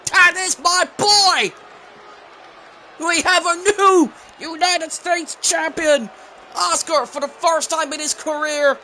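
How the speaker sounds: screaming with joy. Real, not acted. Streamer celebrating his wrestler's title win, screaming. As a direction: celebrate the win at the top of your lungs. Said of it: "I'm sorry for screaming".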